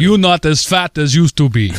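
a deliberately bad, blunt Russian accent; mock a blunt Russian with a clumsy Russian accent. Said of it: "That was a horrible Russian accent".

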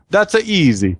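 breezy hammed-up Italian accent; joke Italian accent. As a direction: play the cocky Italian of a joke. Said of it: "the Italian says".

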